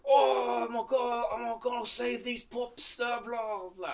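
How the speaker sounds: high, bright Scottish brogue; Scottish accent, Billy Connolly style. Retold or imitated. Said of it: "that's my Scottish accent".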